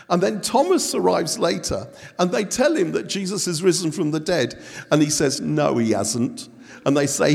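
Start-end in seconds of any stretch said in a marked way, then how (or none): 5.4-6.5 low, slow mock-glum denial; gloomy doubter. Doubting disciple to the others, mock gloomy denial. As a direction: drag it out like a hopeless sceptic.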